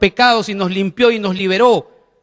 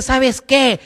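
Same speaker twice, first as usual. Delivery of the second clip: Satan's whisper; 3 semitones higher than usual. Retold or imitated. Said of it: "va a seguir susurrando".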